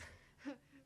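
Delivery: breathy little chuckle of discovery; short laugh. Acted. Amused at her discovery.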